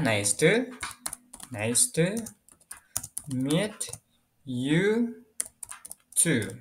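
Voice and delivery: halting, one word at a time; word-by-word dictation. Real, not acted. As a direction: spell out the sentence slowly while writing. Writing out the answer word by word. (didikte pelan, terputus kata demi kata)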